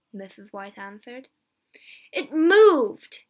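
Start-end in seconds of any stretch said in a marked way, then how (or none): 2.1-3.2 much louder, higher furious shout; angry cry. Acted. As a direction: shout it at his wife in anger.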